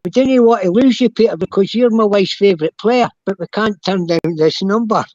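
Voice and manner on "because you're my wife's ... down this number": ordinary talk, nothing marked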